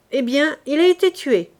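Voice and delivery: shouted reply. Acted. Soldier answers over cannon.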